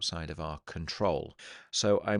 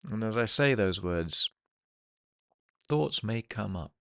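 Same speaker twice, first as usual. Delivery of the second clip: guided meditation. Acted. Hypnotherapist settling listeners into the present moment, guided meditation. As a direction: ease them into the present, phrase by phrase, leaving long silences.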